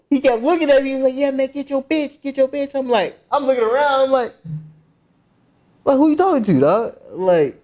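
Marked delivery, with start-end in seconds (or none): 1.1-2.7 high-pitched retold jeer; mock confrontation. Acted quarrel in retold story. 5.8-6.9 high-pitched, challenging question; indignant retort. Retold or imitated. Acted retort in retold quarrel.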